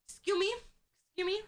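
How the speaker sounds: put-on high small-child voice; imitated little kid. Kid trying to get an adult's attention, high child voice. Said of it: "they be saying and be like".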